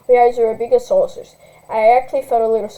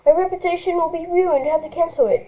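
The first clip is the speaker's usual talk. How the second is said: put-on higher, whining spoiled teen; whiny rich girl; 6 semitones higher than usual. Spoiled girl blaming her mother, lightly whiny. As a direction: complain like a spoiled brat.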